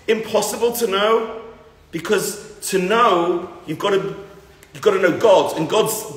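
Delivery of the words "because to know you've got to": ordinary talk, nothing marked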